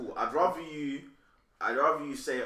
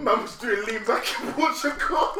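slow, higher, words through laughter; laughing while talking; 7 semitones higher, 5 dB louder, slower than usual. Real, not acted. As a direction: laugh your way through the joke. Joking, laughing through the line.

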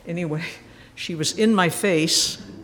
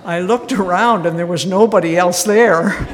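loud, laughing through the words; 10 dB louder than usual. Real, not acted. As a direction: laugh out the punchline. Punchline, audience erupts laughing.

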